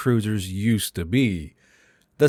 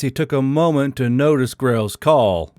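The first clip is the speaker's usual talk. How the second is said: ordinary delivery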